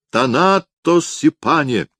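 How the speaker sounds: high, slow barker's grand call; loud carnival barker. Acted. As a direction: sing it out to the crowd like a fairground barker. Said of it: "в манере ярмарочных зазывал".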